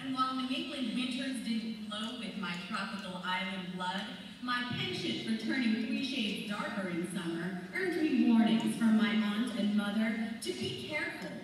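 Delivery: ordinary talk, nothing marked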